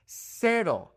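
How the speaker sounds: cero with a sharp snake-like s; hissing S. Shown as a demonstration. Teacher modeling the Mexican S. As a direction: hiss the s in cero. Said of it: "snap sound, like a snake".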